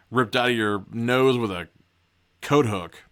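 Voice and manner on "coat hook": ordinary delivery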